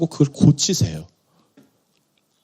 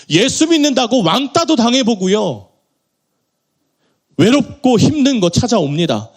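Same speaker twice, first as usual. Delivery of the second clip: raised, higher preaching voice; 8 semitones higher than usual. Acted. Pastor urging the congregation, in a raised preaching voice. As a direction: press the point home to the congregation.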